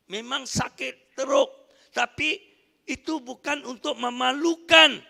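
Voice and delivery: rising to a loud preaching climax; preaching at full force. Acted. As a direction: preach it out, building to the shout. (khutbah berapi-api, semakin lantang)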